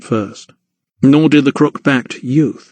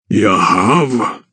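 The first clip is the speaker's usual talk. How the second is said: lower, slow puzzled giant; echoing giant; 3 semitones lower than usual. A narrator's reading. Giant echoes the crookback, low puzzled. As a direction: repeat it wonderingly. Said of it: "echoed the giant".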